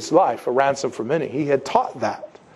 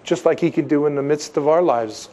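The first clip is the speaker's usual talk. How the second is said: ordinary talk, nothing marked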